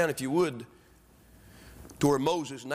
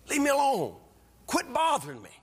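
high, acted annoyance; exasperated brush-off; 12 semitones higher than usual. Telling somebody to leave you alone, exasperated. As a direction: push away someone who keeps pestering. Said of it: "Have you ever told somebody".

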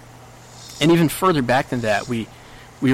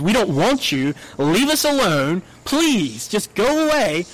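high, rising plea to be left alone; insistent rejection; 7 semitones higher than usual. Acted. Israel telling God to leave them alone, insistent rejection. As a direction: push them away, pitch climbing.

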